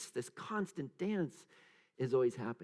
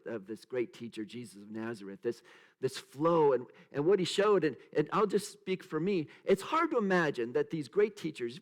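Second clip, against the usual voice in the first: ordinary delivery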